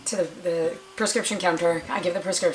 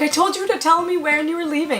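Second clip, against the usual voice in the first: high-pitched, exasperated protest; indignant complaint; 10 semitones higher, 4 dB louder than usual. Retold or imitated. Patient scolding sister for leaving, indignant. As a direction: whine it high at someone who walked off.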